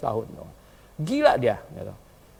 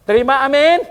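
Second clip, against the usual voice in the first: calling out; 10 semitones higher, 10 dB louder than usual. Real, not acted. Calling the congregation to answer.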